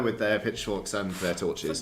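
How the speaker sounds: ordinary delivery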